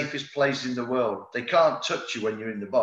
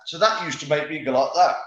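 normal talking voice

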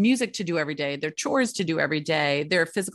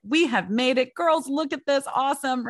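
excited quote; 6 semitones higher than usual. Retold or imitated. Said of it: "And I was like".

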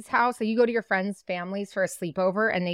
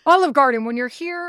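mock ad slogan; 5 semitones higher, 6 dB louder than usual. Quoting the restaurant slogan.

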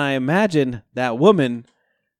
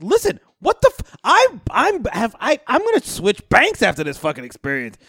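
retold tirade at the bank teller; mock rant; 8 semitones higher than usual. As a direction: blow up at the teller in disbelief. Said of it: "I was like".

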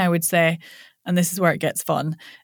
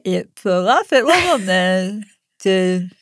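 days said with tongue stuck out. Shown as a demonstration. Coach demonstrating tongue-out warm-up, words blurred by tongue. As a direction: speak around an outstretched tongue. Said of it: "you're gonna stick your tongue out".

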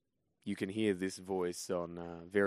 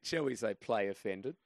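normal talking voice

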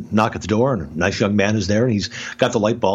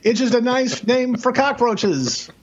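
high, slow, laughing repeat of the punchline; laughing disbelief; 14 semitones higher, much slower than usual. Real, not acted. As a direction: crack up at your own story. Punchline of the hotel story.

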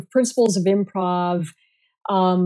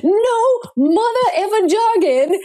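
slow, high, emphatic mock Samuel Jackson; playful Samuel Jackson imitation banning jargon; Sam Jackson impression; 9 semitones higher, slower than usual. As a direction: drag it out like a movie tough guy laying down the law. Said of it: "And he".

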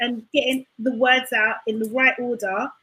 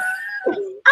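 high burst of laughter; shrieking laugh; 6 dB quieter than usual. Real, not acted. Shrieking laugh at teasing question.

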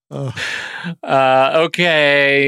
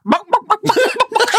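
much higher hammed-up chicken cluck; mock chicken clucking. Imitating the Texan dad taunting his son with mock clucks. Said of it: "are you a chicken?".

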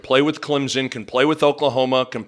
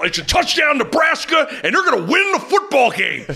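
excited radio call; 11 semitones higher than usual. Retold or imitated. Friend retelling the radio broadcast, excited touchdown call. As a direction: belt it like a play-by-play man. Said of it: "Matt Davis is like".

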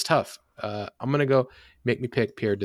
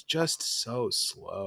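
slow drawn-out; 4 dB quieter, much slower than usual. Retold or imitated. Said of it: "I'm like".

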